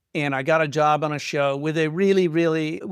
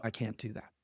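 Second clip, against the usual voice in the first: dropped to a near-murmur, much softer; quiet refusal; 6 semitones lower, 16 dB quieter than usual. Retold or imitated. To his agent, refusing to boast in meetings, very soft. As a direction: pull back, almost under your breath.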